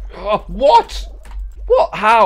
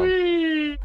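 gleeful whee. Pretend. Dropping through hole.

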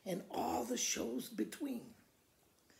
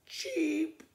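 bird cheep; 8 semitones higher than usual. Acted. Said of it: "The bird said".

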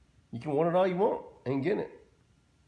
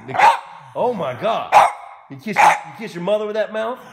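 1 mock shock; 6 semitones higher, 9 dB louder than usual. Mock outrage at dog's wheeze.

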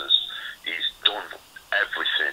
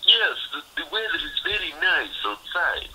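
high-pitched comic foreign-accent impression; Borat; 9 semitones higher than usual. Boxer mimicking his sparring partner's odd small talk, high Borat voice. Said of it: "like, speak like, you know, Borat".

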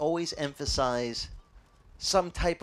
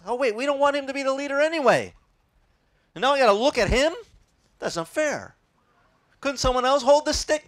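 higher, louder mock whine; whiny complaint; 10 semitones higher, 5 dB louder than usual. Complaining Israelites balking at looking to Moses, higher louder whine. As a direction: sulk like a grumbling crowd.

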